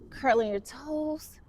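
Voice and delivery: ordinary talk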